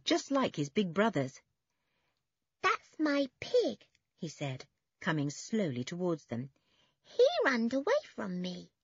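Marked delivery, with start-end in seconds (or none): 2.6-3.9 put-on high, slow little-boy voice; small boy. A narrator's reading. Small boy claims his piglet, small child's voice. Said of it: "said the small boy". 7.0-8.7 put-on high little-child voice; small boy. A narrator's reading. Small boy explains about his piglet, small child's voice. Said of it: "said the small boy".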